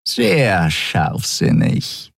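slow purring sneer. Acted. Shiar purrs sarcastically right beside the admiral's ear. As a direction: purr it close to his ear. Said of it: "schnurrte Shiar dicht neben seinem Ohr".

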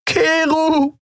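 very high, anguished cry; tearful scream. A narrator's reading. Woman blinded by dust as his ship takes off, screaming. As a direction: scream his name in despair. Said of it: "Sie weinte, hustete und schrie".

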